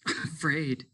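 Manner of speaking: tearful fear. A narrator's reading. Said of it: "cried steadily".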